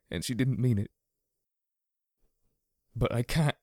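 tearful, breaking; near sobbing. A narrator's reading. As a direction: say it through held-back sobs. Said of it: "He broke off in a shaking sob".

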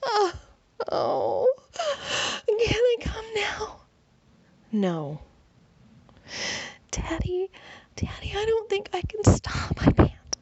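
high, loud, drawn-out pleading. A narrator's reading. She begs Daddy on the edge, high and loud. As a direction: climb up high and loud, begging.

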